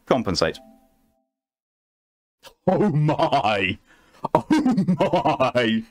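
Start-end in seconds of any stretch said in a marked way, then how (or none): 2.7-5.8 laughing, higher delighted exclaim; a real laughing delighted gasp. Real, not acted. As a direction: giggle through it, delighted. Reacts to the game, laughing.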